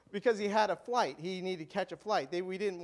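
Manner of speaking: usual speaking voice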